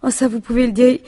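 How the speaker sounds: a widow proudly praising her husband's vigour; proud emphasis. Acted. Widow boasts of husband's strength.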